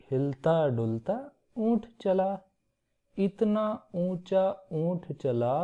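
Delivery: put-on slow, sing-song reading for children; a teacher slowly reciting a children's poem for the class to repeat; slow poem recital. Shown as a demonstration. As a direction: chant the rhyme slowly so kids can follow along. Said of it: "मुंह से बोलकर आपको सुना देते हैं".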